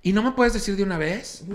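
high, impatient put-on voice; mocking woman's voice. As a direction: press the cashier like a spoiled customer. Imitating the rude woman. (imitación aguda e impaciente)

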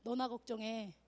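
low, soft, offhand retort; blunt plain version. Acted. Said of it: "쉽게 말하면 이거야". (낮고 무심하게 툭 던지듯)

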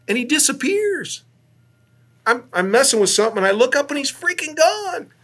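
high-pitched disbelief; incredulous alarm. Retold or imitated. Astronaut telling hosts his partner vanished, high incredulous storytelling. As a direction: voice leaps up in astonishment.

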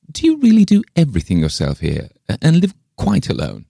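high, animated questioning voice; inquisitive lady visitor. A narrator's reading. Reader voices visiting Miss Pym.

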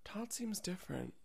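hushed, high, worried movie wife; breathy soap-opera worry, half whispered; Lifetime movie wife. Retold or imitated. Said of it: "with two hands cupping a cup of tea and looking out the window and thinking".

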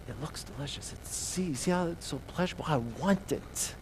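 voicing Eve's longing for the fruit; tempted Eve. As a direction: breathe it like a craving.

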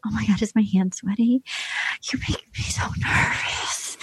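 breathy giddy whisper, mocking Claire; mock nervous whisper. Mocking Claire, nervous and giddy, in a whisper. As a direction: whisper it like a smitten girl. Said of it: "And then she goes".

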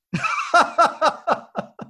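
bursting into a high hearty laugh; real laugh. Real, not acted. Cohost laughing at his own Dark Knight joke, hearty laugh.

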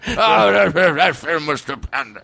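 slurred celebrity impression, slowing at the end; put-on movie-star voice; Jeff Bridges. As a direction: draw it out like a rambling film star. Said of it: "talking like Jeff Bridges".

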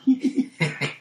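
high, playful character giggle; mock girlish titter; put-on giggle. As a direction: giggle at your own joke, high up. Character giggles at own joke.

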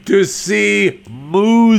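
high, showy delivery rising at the end; theatrical punchline. Pretend. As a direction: stretch "musicals" out high like a showbiz flourish. Sung-out punchline about musicals.

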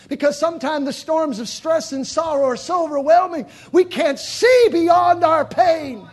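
loud, high, slowing preacher's climax; full-force preaching. Acted. Preacher driving the point home, loud preaching. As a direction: build it and land it hard.

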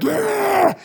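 big high crowd roar; crowd shout. Retold or imitated. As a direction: yell it like a whole club answering. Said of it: "They're like".

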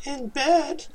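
hammed-up girl voice, very high; Jen. Guest standing in for absent co-host, mock girl voice. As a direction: put on a squeaky mock-female falsetto. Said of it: "That's my Jen impression".

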